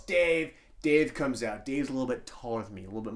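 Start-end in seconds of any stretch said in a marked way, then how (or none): 0.0-0.5 mild mock yell. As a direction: imitate her calling out, slightly raised. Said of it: "she yells".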